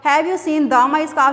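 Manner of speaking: very slow, showing how odd it sounds; slow wrong example. Shown as a demonstration. Teacher slowly testing a wrong article. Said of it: "भी नहीं आ सकता".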